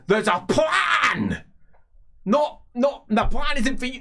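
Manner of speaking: furious shout. Acted. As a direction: roar it at him, red-faced. Boss shouting at underling, face red.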